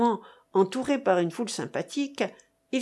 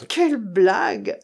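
exclaimed in a put-on character voice; acted outburst of scorn; scornful exclamation; 4 dB louder than usual. A narrator's reading. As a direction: cry out in disbelief at the nonsense. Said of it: "s'écria monsieur Florent".

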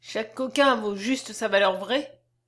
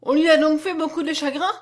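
put-on higher, louder indignant child's question; sudden anger; 6 semitones higher, 7 dB louder than usual. A narrator's reading. Little girl learning the dead man suffered, angry. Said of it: "avec une soudaine colère".

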